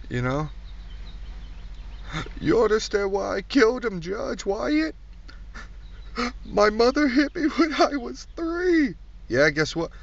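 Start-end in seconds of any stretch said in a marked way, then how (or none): 2.4-8.9 high, slow pleading whine; whiny defendant. Acted. Comic mimics a defendant pleading to the judge, high whine. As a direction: beg the judge like a sniveling criminal.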